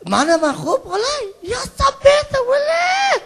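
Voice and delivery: high, loud shouted gran's Farsi rant; a granny yelling in Farsi, way up high. Retold or imitated. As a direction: shriek it like an excited old gran. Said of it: "I learnt it off me gran".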